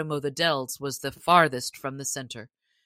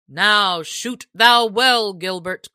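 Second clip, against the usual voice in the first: loud, slow, higher call; sheriff crying out; 3 semitones higher, 8 dB louder than usual. Acted. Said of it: "cried the sheriff".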